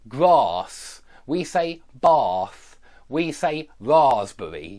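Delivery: grass, bath, raspberry with a long ah, slowly; southern British ah. Shown as a demonstration. Teacher modelling southern British pronunciation. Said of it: "in the south of the U K, we say".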